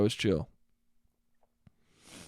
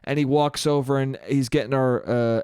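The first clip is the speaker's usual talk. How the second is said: ordinary talk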